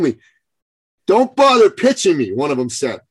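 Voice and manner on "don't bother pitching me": much higher and louder, a dismissive brush-off; mock defiant shout. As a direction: imitate a guy waving off the salesman. Retold defiant attendee refusing pitch.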